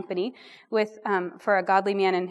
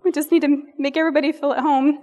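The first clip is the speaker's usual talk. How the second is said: higher, louder, bright hostess put-on; chirpy host voice; 5 semitones higher, 5 dB louder than usual. Rambling speaker mocks herself as an overeager hostess. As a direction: play the cheerful hostess smoothing over awkward silence. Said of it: "I'm like".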